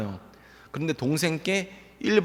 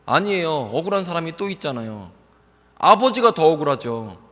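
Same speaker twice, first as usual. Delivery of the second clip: ordinary delivery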